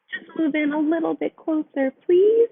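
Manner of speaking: high voice, please rising and loud; pleading mouse. A narrator's reading. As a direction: coax them to squeeze up.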